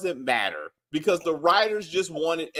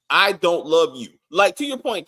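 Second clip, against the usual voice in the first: ordinary delivery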